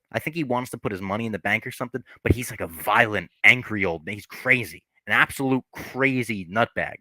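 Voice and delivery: ordinary talk, nothing marked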